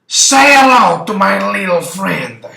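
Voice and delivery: loud, high hammed-up gangster shout; movie line shout. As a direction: blast the famous line at Bob. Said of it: "I turn to Bob and I say".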